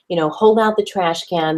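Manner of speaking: ordinary talk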